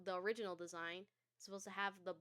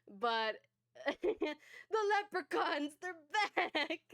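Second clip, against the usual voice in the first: laughing; 7 semitones higher, 8 dB louder, slower than usual. Real, not acted. Laughing at the scare.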